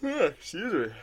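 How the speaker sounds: talking through a yawn; high yawn into an apology. Real, not acted. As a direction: let a real yawn spill into the words. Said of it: "I yawn too much".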